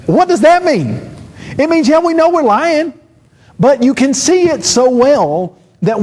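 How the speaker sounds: high, mocking sarcastic voice; sarcastic squeaky take on the excuse; mocking paraphrase.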